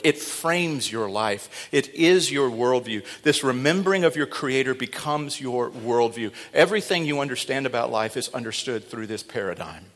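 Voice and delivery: ordinary talk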